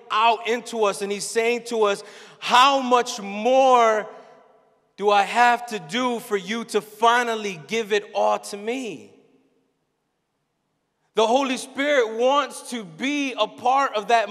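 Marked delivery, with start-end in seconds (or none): none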